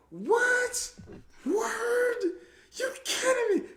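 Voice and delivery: way up high, stunned and delighted; shocked disbelief. Retold or imitated. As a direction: squeak out total disbelief. Stunned by girls' compliment.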